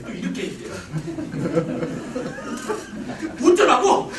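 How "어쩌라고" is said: loud, high exasperated outburst; acted indignant yell; fed-up shout. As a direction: shout it back like a fed-up wife. Fed-up wife snaps at husband.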